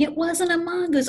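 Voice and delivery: hammed-up uncle's shriek; mock scream. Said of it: "he's screaming".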